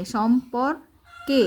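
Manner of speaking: সম্পর্কে slowly split as "shom-por... ke"; slow teacher's "shom-por-ke"; syllable-by-syllable spelling. Shown as a demonstration. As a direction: sound out সম্পর্কে with "ke" set apart. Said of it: "তারপর একার দিছে".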